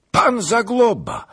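high, loud, drawn-out shout; astonished cry. A narrator's reading. Startled rider recognises Zagloba, high shout. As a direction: cry out the name on recognising him. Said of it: "gdy krzyknął".

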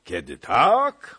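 high, slow, jolly exclamation; tipsy cheerful opener; merry call. A narrator's reading. Pan Jan boasting to the gentlemen, merrily calling out. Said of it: "wołał rozochocony pan Jan".

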